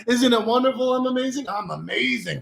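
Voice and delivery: high, soft little singsong; playful high voice. Shown as a demonstration. Showing listeners an affirmation, playful high voice. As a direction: float it up high, then let it soften. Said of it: "You don't have to say it in my voice".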